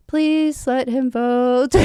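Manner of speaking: beg, half-laughing, for no repeat scene; mock plea. Poll worker begging the judge to let the voter through, slow mock plea.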